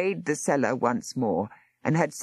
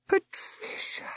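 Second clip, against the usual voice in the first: cried out; 5 dB quieter, slower than usual. Acted. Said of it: "Miss Boston cried".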